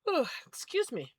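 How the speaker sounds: talking through a yawn; mid-yawn apology. Real, not acted. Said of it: "I don't know why I'm yawning".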